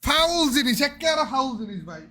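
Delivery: startled shout. Real, not acted. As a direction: yell it out after a fright. হঠাৎ ভয়ে চেঁচিয়ে ওঠা. (ভয়ে চমকে জোরে চিৎকার)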